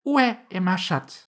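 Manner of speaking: high, slow schoolbook French; French lesson sentence. A narrator's reading. Alice tries French on the mouse, careful French recitation. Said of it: "first sentence in her French lesson book".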